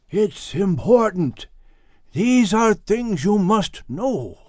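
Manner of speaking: put-on slow weighty words of an old sage; wise old man voice. A narrator's reading. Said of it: "The wise old man shrugged and said".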